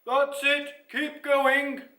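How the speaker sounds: high, loud encouraging call; calling from the back. A narrator's reading. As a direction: call ahead to the group as a lion. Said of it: "called Ralph from the back".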